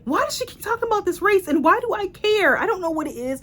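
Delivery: exasperated mock rant. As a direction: play your confused, annoyed self reading it. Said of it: "and I was like".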